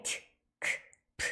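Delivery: 트, 크, 프 whispered as voiceless sounds; unvoiced stops. Shown as a demonstration. Teacher showing voiceless consonants after S. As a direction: breathe out 트, 크, 프 with no voice. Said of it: "S 다음에 무성음이 올 경우에".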